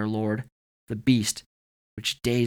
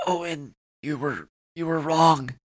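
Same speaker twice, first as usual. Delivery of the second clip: higher, strained, broken phrasing; pained, halting speech; 6 semitones higher than usual. A narrator's reading. Chance in agony speaking to Owen, strained. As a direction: struggle to get the words out.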